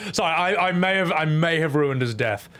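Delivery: normal talking voice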